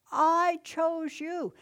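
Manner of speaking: slow, lifted proclamation; emphatic Jesus. Acted. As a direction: land every word of it.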